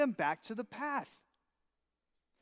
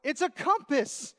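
ordinary talk, nothing marked

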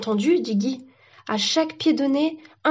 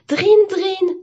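high, ringing bell imitation; high sing-out ring; imitated ringing; 10 semitones higher, 5 dB louder than usual. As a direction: mimic a phone ringing.

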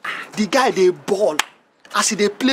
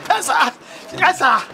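anxious obedience; 7 semitones higher than usual. Acted. Gateman fears being sacked.

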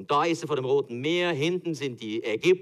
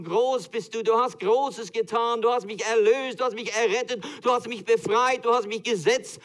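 preaching praise; 9 semitones higher, 5 dB louder than usual. Acted. Preacher quoting the worship song, fervent praise. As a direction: proclaim it like worship.

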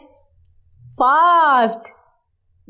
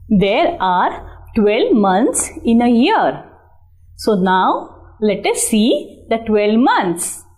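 ordinary talk, nothing marked

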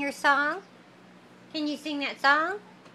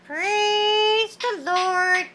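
slow drawn-out praise; 5 semitones higher, much slower than usual. Shown as a demonstration. Teaching the bird a phrase.

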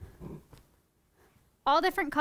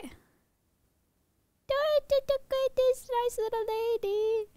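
very high, slow singsong; playful little-lady voice; 8 semitones higher, 3 dB quieter, much slower than usual. Pretend. Presenter plays with the Princess Peach lookalike, high singsong. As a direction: draw it out in a high, playful lilt.

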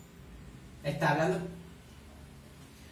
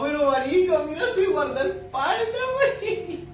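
emoción; 8 semitones higher, 6 dB louder than usual. Real, not acted. Reacting to the bodyguard on screen.

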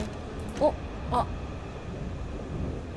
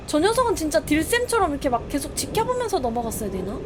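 normal talking voice

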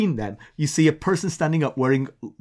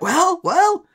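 high, loud, eager singling-out; expectant prodding; 14 semitones higher, 5 dB louder than usual. Retold or imitated. Brother on siblings' thread awaiting news, eager prodding. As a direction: pitch it way up, fishing for a reaction.

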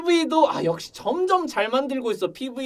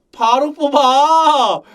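loud, higher, long drawn-out cry; excited exclamation; 4 semitones higher, 8 dB louder, much slower than usual. Real, not acted. Fan decides to pull the character at once, loudly excited.

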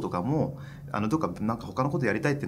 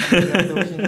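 laughing; 8 semitones higher, 9 dB louder than usual. Real, not acted. Guest laughing at the host's joke.

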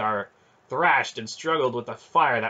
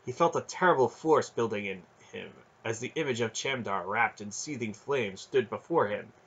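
usual speaking voice

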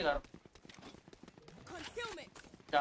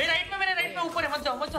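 raised, rapid-fire warning callout; urgent warning; 6 semitones higher, 3 dB louder than usual. Real, not acted. Player warning teammates of an enemy on the right, urgent. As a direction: stop teammates from walking into danger.

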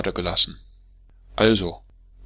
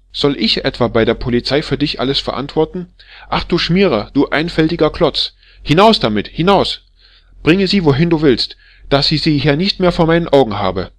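much louder and higher, screaming insults; enraged shouting; 6 semitones higher, 8 dB louder than usual. Acted. Wife ordering her husband out with the nose, enraged shouting. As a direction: drive him out of the house with fury.

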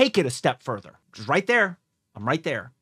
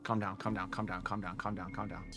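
soothing himself; 9 semitones lower, 16 dB quieter than usual. Real, not acted. Viewer calming himself while angry, soft mutter. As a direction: talk yourself down under your breath. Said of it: "I'm getting so mad".

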